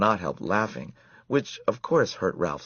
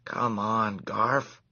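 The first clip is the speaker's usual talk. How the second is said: coaxing; 4 semitones higher, much slower than usual. A narrator's reading. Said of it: "coaxed Ralph".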